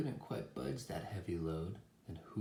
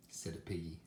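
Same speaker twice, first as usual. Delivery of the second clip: usual speaking voice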